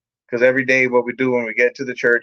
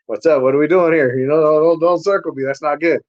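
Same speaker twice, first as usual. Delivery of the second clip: raised-pitch, wary challenge; alarmed protest; 4 semitones higher than usual. Retold or imitated. Newcomer protesting as the house circles around him, alarmed. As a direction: push back as if cornered.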